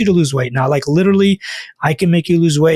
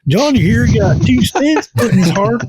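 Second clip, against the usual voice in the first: higher, hammed-up mock accent of the doctor; exaggerated Ken Berry accent; 6 semitones higher than usual. Keto doctor advising Johnny to eat butter, in a hammed-up mock accent. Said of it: "not trying to make fun of his accent".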